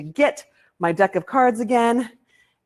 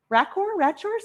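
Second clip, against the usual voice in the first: normal talking voice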